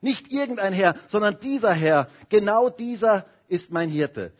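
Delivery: ordinary talk, nothing marked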